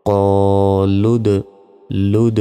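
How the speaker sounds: লুদ ends with a sharp echoing bounce on the দ; qalqala bounce. Shown as a demonstration. Teacher shows qalqala on লুদ, bouncing the দ. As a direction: jolt the final দ in লুদ so it bounces. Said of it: "এই যে ধাক্কা দিলাম এটাই কলকলা।".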